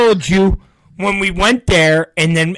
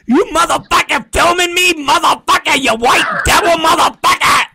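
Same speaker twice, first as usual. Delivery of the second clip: high, yelling mock tirade; hammed-up furious high yell; imitating angry woman; 11 semitones higher than usual. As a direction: scream it like the woman in the car. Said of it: "say that again".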